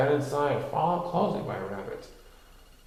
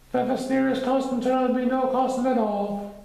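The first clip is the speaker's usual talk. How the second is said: high Pooh voice; 12 semitones higher, 4 dB louder than usual. A narrator's reading.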